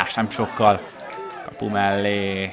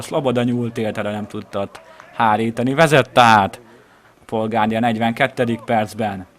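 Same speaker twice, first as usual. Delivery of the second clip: ordinary talk, nothing marked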